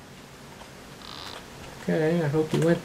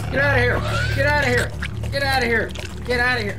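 frantic shout; 8 semitones higher, faster than usual. Real, not acted. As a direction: yell it out to drive him off. Shouting at the monster chasing him.

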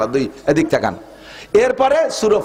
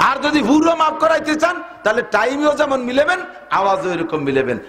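high, fervent sermon voice; preaching at full force; 9 semitones higher than usual. Acted. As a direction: preach it out with rising fire. (উচ্চস্বরে জোরালো ওয়াজ)

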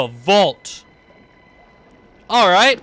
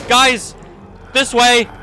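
calling out; 6 semitones higher, 5 dB louder, slower than usual. Real, not acted. Calling out to allies.

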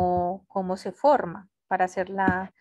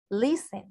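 "Listen" stressed on the first syllable; LIS-ten, light on the second syllable; correct word stress. Shown as a demonstration. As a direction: model "Listen" with the weight up front. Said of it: "acá es donde yo digo listen".

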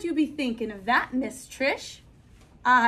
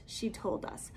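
ordinary delivery, nothing marked

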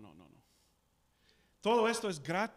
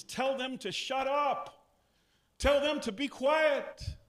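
indignant command; 6 semitones higher, 3 dB louder, slower than usual. Acted. Religious leaders ordering Jesus.

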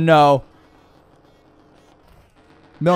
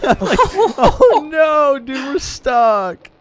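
laughing; 9 semitones higher, 4 dB louder, much slower than usual. Real, not acted. Stuck in game, laughing.

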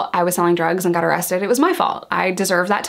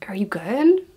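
whispered furtive check; secretive retold whisper; hushed aside. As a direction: ask it under your breath, as if guards are near. Furtive check on friend before search.

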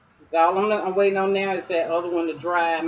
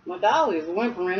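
ordinary talk, nothing marked

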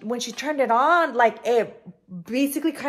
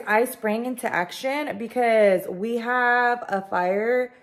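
usual speaking voice

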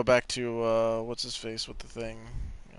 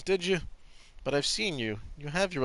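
normal talking voice

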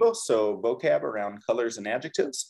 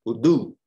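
uDU, louder on the final syllable; stress on last syllable; 2 semitones lower, 5 dB louder than usual. Shown as a demonstration. Teacher models shifted emphasis for students, Udu stressed on last syllable. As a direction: punch the end of Udu. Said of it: "kind of shifting the emphasis".

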